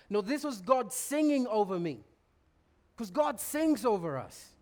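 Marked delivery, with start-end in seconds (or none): none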